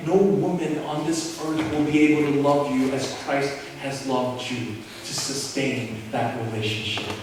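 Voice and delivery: normal talking voice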